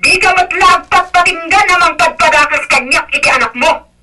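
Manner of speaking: high, indignant tirade; heated, high-pitched complaint; angry accusation. Acted. Quarrel between in-laws.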